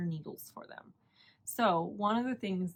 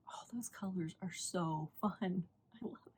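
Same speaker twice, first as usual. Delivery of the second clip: hushed admiration; 7 dB quieter than usual. Real, not acted. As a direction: drop to a soft whisper, savoring it. Gushing softly over sock colors.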